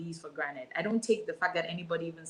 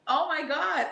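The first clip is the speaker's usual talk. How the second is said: overwhelmed exclamation; 9 semitones higher, 4 dB louder, slower than usual. Real, not acted. Guest overwhelmed when asked feelings.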